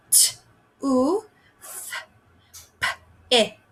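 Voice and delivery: toothpick split into t, oo, th, p, ick; teacher segmenting toothpick for the class; sounds segmented. Shown as a demonstration. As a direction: sound out each phoneme of toothpick. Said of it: "if we're blending it".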